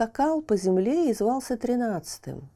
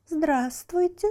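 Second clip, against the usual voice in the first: high, slow, rising hello; mocking greeting; 8 semitones higher, slower than usual. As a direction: greet him sweetly, slowly. Water greets chick.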